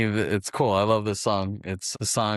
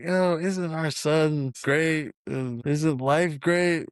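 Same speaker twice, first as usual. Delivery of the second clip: higher and slow, syrupy TV-family gush; mock sitcom cheer; 6 semitones higher, much slower than usual. Bender parodying a perfect household with slow sitcom cheer. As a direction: drag it out like a too-perfect fifties sitcom. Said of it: "he reenacts this whole Leave It to Beaver type of thing".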